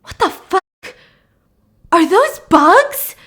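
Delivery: high, slow, stunned question; horrified disbelief. Retold or imitated. Charlotte spots bugs in the throw up, shocked. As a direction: react in mounting shock. Friend spots maggots in vomit.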